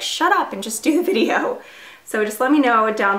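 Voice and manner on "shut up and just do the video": higher-pitched mock annoyed commenter; impatient viewer. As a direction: imitate a fed-up viewer in a raised pitch. Voices impatient viewers in retelling.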